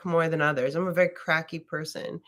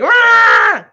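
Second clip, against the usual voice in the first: much higher, louder hammed-up yell; mock scream; 15 dB louder than usual. Herself screaming on the massage table, mock yell. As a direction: let out the scream yourself. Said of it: "I was the woman in there".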